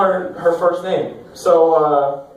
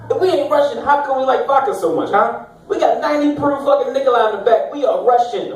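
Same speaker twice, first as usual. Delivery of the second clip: higher-pitched, mock-indignant arguing; mock insistent uncle; 6 semitones higher than usual. Drunk uncle arguing with his nephew, mock-insistent. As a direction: press an absurd case, pitched up.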